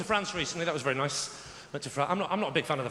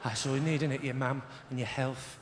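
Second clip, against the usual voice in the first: put-on friendly-threatening London accent; 8 semitones lower than usual. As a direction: make London small talk sound like a threat. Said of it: "the more threatening it becomes".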